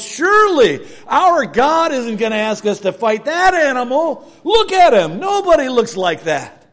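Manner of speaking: loud, high, alarmed disbelief; soldiers' loud protest. Retold or imitated. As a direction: cry out like scared soldiers. Frightened soldiers voiced in story.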